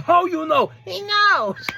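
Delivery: very high, playful imitated cry; mock high exclaiming. Recapping Andrade and Rush having a moment, high mock cries. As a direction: pitch the exchange way up high. Acted exchange between two wrestlers.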